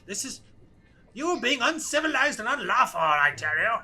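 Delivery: high, loud protest in character; indignant prisoner. Acted. Prisoner telling captors they are unlawful, high-pitched indignation. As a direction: protest like an official wronged by ruffians.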